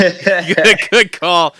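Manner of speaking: loud, high good call; hammed-up approval. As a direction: boom out the approval. Repeats quote louder, hammed.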